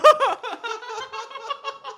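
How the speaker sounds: shrieking high laugh. Real, not acted. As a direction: burst into a squealing laugh. Friends reacting to the hot chip.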